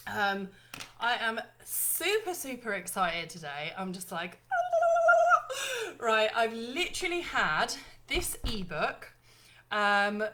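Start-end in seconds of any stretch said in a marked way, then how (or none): 4.4-5.9 loud high burst of laughter; giddy shrieking laugh; high squealing laugh. Real, not acted. Laughs at excitement.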